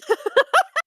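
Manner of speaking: high, loud cackling laugh; old villager cackles wildly at the Empress's might. Acted. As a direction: let out a shrieking old-lady cackle. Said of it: "this cackling old lady".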